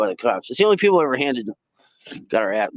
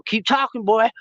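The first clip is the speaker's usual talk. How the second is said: sergeant taunting; 13 semitones higher than usual. Retold or imitated. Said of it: "She's all".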